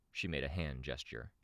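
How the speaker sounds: ordinary delivery, nothing marked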